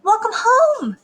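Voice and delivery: high, loud greeting; lilting motherly welcome; cheery mother. A narrator's reading. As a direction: greet your child in a singsong high voice. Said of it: "said her mother".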